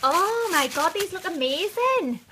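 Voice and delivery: high, loud thrilled squeal; excited. Real, not acted. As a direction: burst out in delight. Opens the lip glosses.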